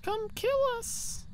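sing-song high taunt, drawn out; high slow taunt. Real, not acted. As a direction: dare the ghost, high and slow. Taunting the ghost during hunt.

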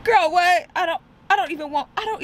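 high, loud incredulous "what?"; shocked and indignant; outraged disbelief. Real, not acted. Outraged at the massage lady's request.

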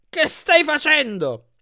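high, drawn-out shrieked question; shrieking. A narrator's reading. Annabeth protests to Percy from the bed, shrieking. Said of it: "strillò Annabeth".

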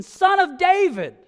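desperate yell. Retold or imitated. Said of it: "he yells at the top of his lungs out of sheer desperation".

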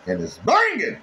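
high, hyped-up cheer over the food; hyped exclamation. Real, not acted. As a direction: sell how good it tastes. Cook hyping his catfish dish.

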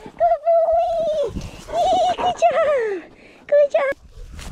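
very high, loud, slow dog praise; squeaky praising a dog. Real, not acted. Praising dog after find.

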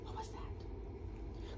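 slow, breathless spooky whisper; scared whisper. Acted. Teacher whispering the spooky line to the listening class. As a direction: whisper as if something's at the door.

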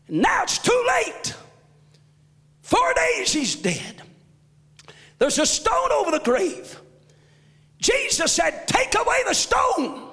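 shouted gospel preaching with rising fervor; full-force preaching. Acted. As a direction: lift the church with each line.

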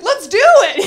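loud, high rallying cry; hammed-up gung-ho holler; gung-ho shout. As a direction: yell it with mock reckless enthusiasm. Acted reckless resolve, calling out.

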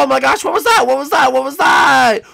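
high, loud startled shouts; loud, hammed-up fright; mock alarm. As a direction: call out in pretend alarm. Pretending to be startled awake.

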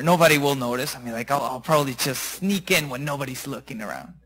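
low, soft thinking aloud; furtive self-talk. Acted. Rich man planning to sneak in unseen, furtive and hushed. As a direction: murmur it as a private plan to slip in.

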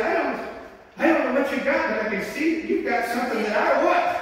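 a hint of an eager lady; quoting the lady. Lady at the door telling the preacher, lightly voiced woman.

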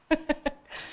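short, high chuckle; real laugh. Real, not acted. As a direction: laugh lightly at the technical glitch. Jokes about failing electronics. (risatina acuta e spontanea)

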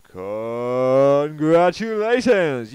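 slow, drawn-out and loud, like a prize announcer; mock game show host, very slow and loud. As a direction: stretch it out loud as if announcing a winner. Sarcastic award announcement.

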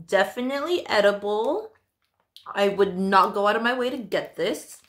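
talking between bites; chewing with grimace; mouth partly full, chewing. Real, not acted. Seen 2: Mouth seems partly full during speech, grimacing; snack held earlier.

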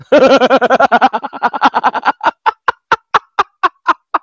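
drawn-out high cackle; high, pulsing laugh that slows as it goes; long mocking laugh. Real, not acted. Scoffs at yet another disagreement.

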